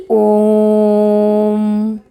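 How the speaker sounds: ओम held very long and slow, like a chant; long drawn-out ओम. Shown as a demonstration. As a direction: stretch ओम out as long as you can. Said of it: "देर तक हम बोल रहे हैं". (ओम को बहुत देर तक खींचकर बोलें)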